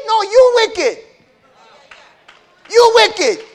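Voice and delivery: high, loud accusation; accusing shout. Acted. Preacher accusing the sinners, loud and high. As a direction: point the finger and shout it.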